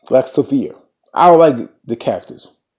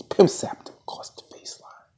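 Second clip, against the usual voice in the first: ordinary talk, nothing marked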